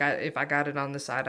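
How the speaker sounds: normal talking voice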